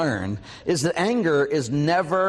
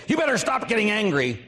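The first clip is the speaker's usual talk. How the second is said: nagging spouse; 9 semitones higher than usual. Retold or imitated. Nagging wife imitated.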